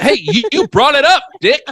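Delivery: loud, high, fake-outraged comeback; mock indignant yell. Retelling telling off a stranger who asked, loud mock outrage. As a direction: snap it back like you've been wronged.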